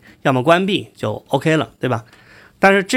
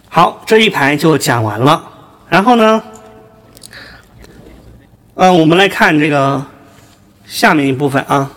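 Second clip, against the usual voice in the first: louder, electronic autotuned voice; autotune effect; 5 dB louder than usual. Shown as a demonstration. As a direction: run through autotune. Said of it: "这个是电音".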